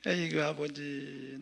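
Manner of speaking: slow, low, sighed appeal; pitying lament. Real, not acted. Pastor to God about his divided country, pitying lament. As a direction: sigh it out softly and heavily.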